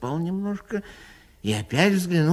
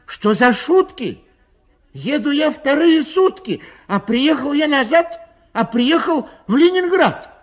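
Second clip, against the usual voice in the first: high, loud outraged cry; a passenger yelling at the station; shouting; 11 semitones higher, 5 dB louder than usual. A narrator's reading. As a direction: yell it in disbelief. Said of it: "Закричал он".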